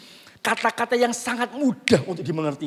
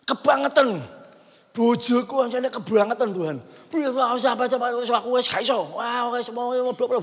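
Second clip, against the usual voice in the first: mock complaint; 5 semitones higher, 4 dB louder than usual. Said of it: "saya membela diri".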